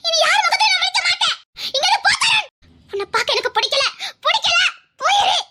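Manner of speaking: high, tearful screaming; shouting in anguish. Acted. Girl refusing to see anyone, shouting in anguish. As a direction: scream the rejection through pain. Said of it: "வேதனையில கத்திட்டு இருக்கா".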